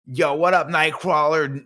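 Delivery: put-on Jersey accent. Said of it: "I'm still stuck in that Jersey accent".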